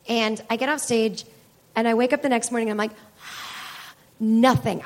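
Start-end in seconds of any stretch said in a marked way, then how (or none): 3.3-3.8 empty breath where a voice should be; voiceless croak attempt. Comic showing how she woke up voiceless, airy exhale. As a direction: try to speak, only air comes out. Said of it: "I've never lost my voice this bad".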